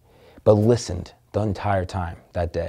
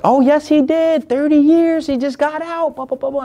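high-pitched mock girl's voice, louder at the start; imitating the girl; 5 dB louder than usual. Girl at the bar answers for the veteran in a high mock voice. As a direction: squeak it out as the chatty girl, fading off at the end. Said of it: "And the girl's like".

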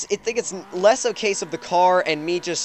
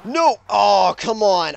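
anguished denial; 2 semitones higher, 4 dB louder, much slower than usual. Real, not acted. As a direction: protest the spin, dragging the words. Car spins out.

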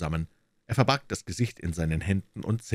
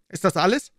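sharp, higher, louder question from the inspector; 12 semitones higher, 6 dB louder, faster than usual. A narrator's reading. Inspector Hopkins pressing the suspect, sharp higher question.

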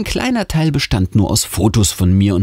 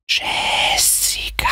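slow, breathy whisper calling a name; eerie whisper; 4 dB quieter, much slower than usual. A narrator's reading. A voice from the wardrobe whispers to Jessica, eerie whisper. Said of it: "dieses Flüstern".

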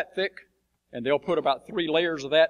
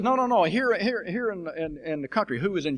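insistent official; 6 semitones higher than usual. Retold or imitated.

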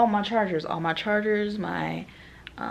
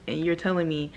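normal talking voice

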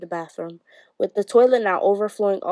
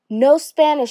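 louder, higher narrated bellow; bellowed order; 6 semitones higher, 6 dB louder than usual. A narrator's reading. Huge teacher rushes in on the children, bellowing. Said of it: "she bellowed".

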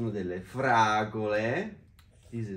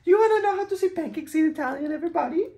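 excited; 3 dB louder, faster than usual. Real, not acted. Excited mom to kids on camera.

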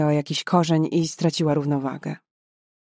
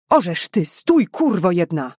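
a louder, slowed mock shout after her; guard lightly shouting after the fleeing girl; angry yell; 4 dB louder than usual. A narrator's reading. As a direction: yell at the runaway to stop. Soldier yells after fleeing girl.

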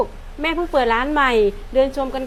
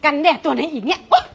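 annoyed yell; 3 semitones higher, 6 dB louder than usual. Acted. Mother and child quarrel over sunscreen.

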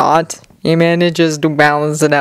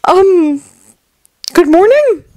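higher, unsure and sheepish; sheepish teen voice; 12 semitones higher, 3 dB louder, slower than usual. Acted. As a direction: ask it like a guilty kid. Izuku sheepish greeting.

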